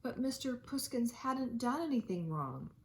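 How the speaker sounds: ordinary delivery, nothing marked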